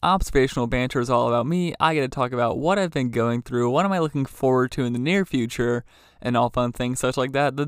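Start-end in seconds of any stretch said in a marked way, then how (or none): none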